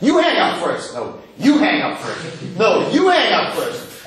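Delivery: drawn-out, playful mock pleading; lovesick little kids. Teacher imitating engaged couple on the phone, childish coaxing. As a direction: coo it like squabbling little kids. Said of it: "Like two little kids".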